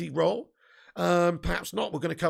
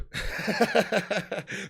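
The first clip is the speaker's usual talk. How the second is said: real laugh. Real, not acted. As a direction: burst of chuckling. Laughs before resuming.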